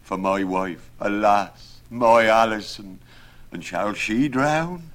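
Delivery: distraught rustic husband; grieving carpenter. A narrator's reading. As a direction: wail in dismay for a beloved wife. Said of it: "For grief at this he nearly tumbled".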